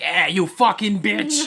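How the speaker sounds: hammed-up foul-mouthed persona; crude anime girl. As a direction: stretch the insult out, loud and comic. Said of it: "my fucking voice going like".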